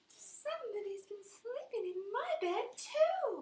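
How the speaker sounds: high pitched whisper, mama bear. Acted. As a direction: breathe it out high and scared.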